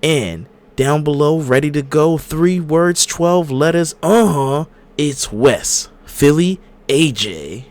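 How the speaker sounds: announcer intro. Acted. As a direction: hype up the next host. Hosts podcast intro.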